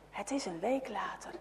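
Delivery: plain reading voice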